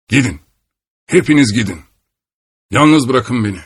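a dying man's desperate shout. A narrator's reading. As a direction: drive them all out with a cry. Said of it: "diye bağırdı".

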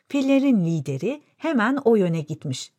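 usual speaking voice

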